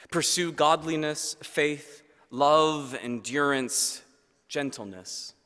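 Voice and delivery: ordinary talk, nothing marked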